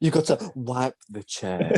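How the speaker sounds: slow, lightly voiced wise master; a hint of a slow martial-arts teacher; Mister Miyagi. Teasing him with master's advice on chair, slow mock-sage. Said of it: "Mister Miyagi shit".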